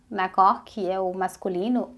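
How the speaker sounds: ordinary talk, nothing marked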